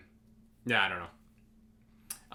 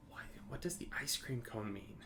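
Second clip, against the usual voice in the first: hushed baffled whisper; 14 dB quieter than usual. Retold or imitated. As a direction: whisper it, puzzled. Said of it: "Wall Street people ... offices like".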